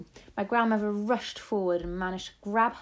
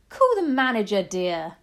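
outraged demand; 2 semitones higher, 10 dB louder than usual. A narrator's reading. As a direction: demand it loudly of your husband. Wife demands grandmother be removed.

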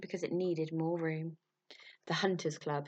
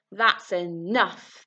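louder, very slow, heavy command; thundering command; 7 dB louder, much slower than usual. Acted. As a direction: lay down the law as the colonel. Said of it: "thundered Colonel Bakawackley".

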